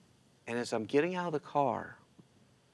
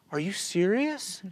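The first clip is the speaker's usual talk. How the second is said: drawn-out, higher-pitched disbelief; retold disbelief; 9 semitones higher, slower than usual. Family offered a free ticket, incredulous. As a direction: imitate someone astonished by a gift. Retells stunned family's reaction to gift.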